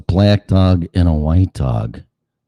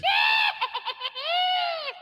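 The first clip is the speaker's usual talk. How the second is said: mock shrieking laugh; 13 dB quieter than usual. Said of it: "at their dog and go".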